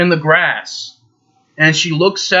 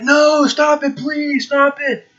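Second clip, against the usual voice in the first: much higher, pleading mock voice; alarm clock begging; 11 semitones higher, slower than usual. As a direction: whine at him to make it stop. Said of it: "It's like".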